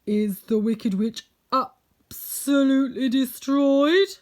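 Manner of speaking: booming wizard, stretching the key words. A narrator's reading. Unseen wizard asks after the witch, loud and drawn out. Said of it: "it trembled a touch".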